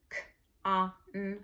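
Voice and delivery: "k, a, n" as isolated letter sounds; sounding out. Shown as a demonstration. Teacher spelling the first syllable, sounding out. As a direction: pause between each sound of "k, a, n". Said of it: "you wanna spell by syllable".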